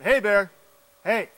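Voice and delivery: loud, high holler to a bear; big, high-pitched attention-getting call; shouted warning. Shown as a demonstration. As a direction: shout it far out into the woods. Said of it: "shouted out".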